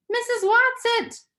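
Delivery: high, loud call full of despair. Acted. A girl calling for her absent protector, high and despairing. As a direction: call out for help, losing hope. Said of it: "Meg called despairingly".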